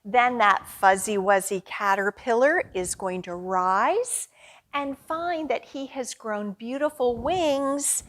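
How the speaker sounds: ordinary delivery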